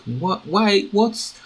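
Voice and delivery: ordinary delivery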